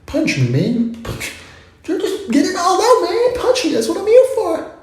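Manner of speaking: squeaky cheerful dare to punch; eager punching bag. Retold or imitated. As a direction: egg on the puncher with silly excitement. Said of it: "Punching bags are like".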